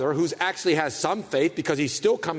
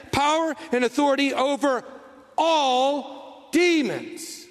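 Power and authority over, then a long pause and all demons drawn out very slowly; slow stress on all demons; 9 semitones higher, slower than usual. Real, not acted. Preacher repeating the verse to his congregation, slow heavy stress. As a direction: lean hard on all demons, stretched out. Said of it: "Did you hear that?".

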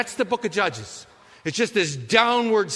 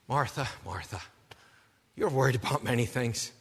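gentle rebuke; 7 semitones lower, 6 dB quieter than usual. Retold or imitated. Jesus answers gently.

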